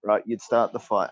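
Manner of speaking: ordinary talk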